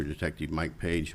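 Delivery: ordinary delivery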